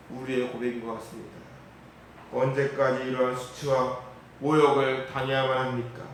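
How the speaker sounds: ordinary talk, nothing marked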